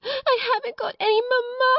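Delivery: put-on very high, whining child's wail. A narrator's reading. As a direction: bawl it out like a bereft little girl. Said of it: "angry wails of some child".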